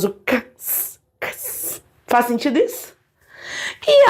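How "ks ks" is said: KS as bare consonants, soft k then hissed s; two-phoneme demo. Shown as a demonstration. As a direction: isolate KS without any vowel, softly. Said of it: "remetendo a dois fonemas".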